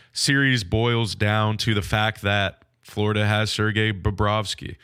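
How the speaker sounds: ordinary delivery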